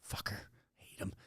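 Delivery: joking whispered dig at a rich friend; whispered aside. Real, not acted. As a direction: drop to a breathy whisper for the jab. Joking jab at old teammate.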